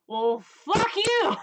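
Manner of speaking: put-on slow, loud, slurred drunken snarl at the guy behind him; drunk dude voice. Drunk patron snapping back at the guy behind him, slow and loud. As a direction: drag it out like a belligerent drunk. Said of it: "That's my drunk dude voice".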